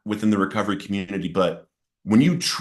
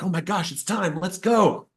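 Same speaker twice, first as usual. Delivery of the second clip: imagined excitement; 9 semitones higher than usual. Acted. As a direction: act out an eager reaction. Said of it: "you would get excited".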